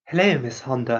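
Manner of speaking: a hint of a gentle anime prince greeting Miss Honda; Yuki Soma voice. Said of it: "I can do a sort of Yuki Soma voice".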